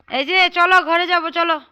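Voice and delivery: loud, high call across the yard. Real, not acted. Calling children to come inside.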